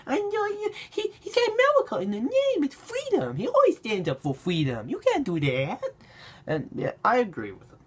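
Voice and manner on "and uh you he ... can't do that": high-pitched, mocking whine; squeaky mock indignation; whiny Twitter bandwagoner. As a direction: imitate an outraged online fan, pitched way up. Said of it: "people on Twitter right now are bandwagoning".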